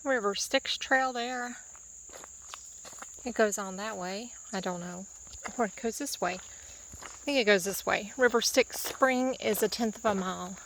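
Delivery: ordinary talk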